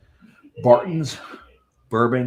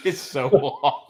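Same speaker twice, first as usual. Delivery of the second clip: laughing, slow and high; 6 semitones higher, slower than usual. Real, not acted. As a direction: laugh out the words. Laughing at crooked label.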